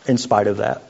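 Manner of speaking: usual speaking voice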